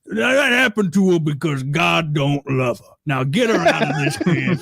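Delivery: exaggerated Southern voice; Dan Soder. Repeating a comedian's cruel line, mock Southern impression. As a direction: condemn her like a Southern grump. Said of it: "He's like".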